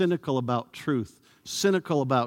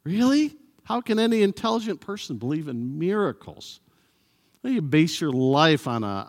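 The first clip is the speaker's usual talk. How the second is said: cynical sneer; 2 semitones higher than usual. Retold or imitated. Cynic mocks a believer's faith in miracles, sneering. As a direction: scoff like a sceptic with raised eyebrows. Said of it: "People will say, or maybe just with their raised eyebrows".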